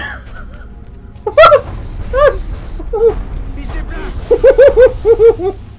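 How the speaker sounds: high, loud laughing fit; real tearful laughing fit; long helpless laugh. Real, not acted. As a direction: laugh until crying. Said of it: "Oh, I'm crying".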